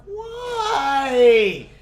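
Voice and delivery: a high, wailing mock whine; exasperated whine. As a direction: let the word sag like a despairing cry.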